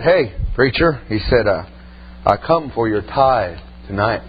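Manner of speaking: low, slow and menacing, demanding the tithe; a sinister visitor talking down to him; menacing stranger. Retold or imitated. Preacher retelling a stranger demanding his tithe, low and menacing.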